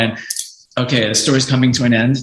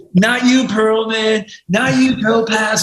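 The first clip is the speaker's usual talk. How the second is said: obnoxious high-pitched taunting call; mock colleagues across the whole office; Michael Dorf; 11 semitones higher, slower than usual. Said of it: "like, really loud and obnoxious".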